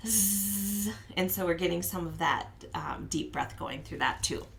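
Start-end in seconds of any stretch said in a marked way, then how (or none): 0.1-0.9 sharp inhale, then buzzing; gasp then bee buzz. Shown as a demonstration. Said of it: "we can pretend we're the buzzy bee".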